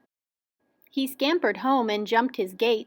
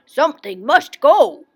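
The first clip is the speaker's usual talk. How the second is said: loud, raised cry of dismay; alarmed exclamation; 4 semitones higher, 9 dB louder than usual. A narrator's reading. Willoughby finds the tree too tall, loudly alarmed. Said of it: "he gasped".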